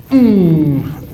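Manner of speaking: loud falling "mmm" for the swing; effort hum. Shown as a demonstration. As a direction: hum the push of the swing loudly.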